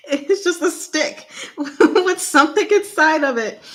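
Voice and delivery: laughing through the words. Real, not acted. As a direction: giggle while you say it. Laughs at mystery candy.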